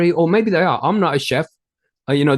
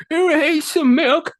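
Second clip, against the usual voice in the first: put-on high whiny teen girl wail; mock crying teenage girl; 13 semitones higher than usual. Girl running off in tears, mock whiny sob voice. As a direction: whine it like a sulking teenager running off. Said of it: "running off crying".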